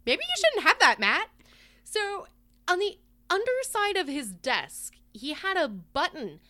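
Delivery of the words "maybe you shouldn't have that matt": higher, louder quoted chiding; mild mock objection. Co-host voicing what nobody told Matt, mock objection. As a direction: lift it into a tentative protest. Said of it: "everybody was like".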